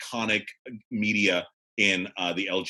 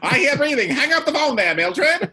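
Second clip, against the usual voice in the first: put-on high, loud old-time voice; party-line caller; 6 dB louder than usual. Caller scolding Mildred on the party line, high and loud.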